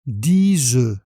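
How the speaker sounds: "dix œufs" linked as di-z-œufs; liaison demo. Shown as a demonstration. Teacher to learner, modelling the liaison: z linked. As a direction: carry a z into "œufs". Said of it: "avec la liaison".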